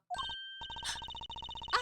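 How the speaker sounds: high sharp gasp of shock; startled gasp. Acted. Attendant jerks awake, high startled gasp.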